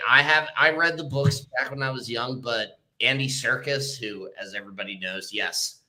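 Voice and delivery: ordinary delivery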